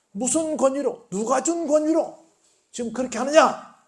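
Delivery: high-pitched, indignant cross-questioning; confrontational challenge. Retold or imitated. Elders challenge Jesus about his authority, confrontational demand. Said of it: "라고 막 따집니다".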